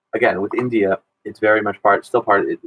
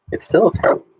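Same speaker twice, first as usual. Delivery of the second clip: much higher, insisting on still; teasing indignation, pitched high; mock outrage; 10 semitones higher, much faster than usual. Real, not acted. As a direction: jump way up in pitch on It's still a terrible movie, leaning on still. Mock outrage at a student's film pick.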